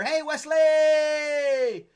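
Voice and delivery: long, loud, high shout across the room; drawn-out happy yell of a name; calling out. Real, not acted. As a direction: stretch the name out to greet a friend. Calling out to arriving friend.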